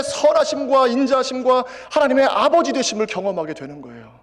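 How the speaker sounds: loud, high-pitched preaching climax; fervent preaching. Acted. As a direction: preach it with full conviction. Preaching at full force. (힘찬 설교조로 높고 크게)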